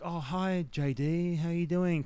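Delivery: put-on American accent, slightly lifted. Answering the producer's call, tentative American accent. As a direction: try a mild American phone greeting. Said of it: "is that your American accent".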